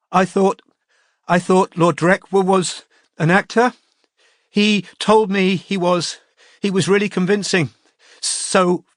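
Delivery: high, broken, crying confession; tearful. A narrator's reading. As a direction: stumble through tears.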